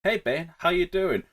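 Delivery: hushed casual greeting; low talking volume. Shown as a demonstration. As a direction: ask it very quietly, as a contrast. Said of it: "someone's talking at this volume".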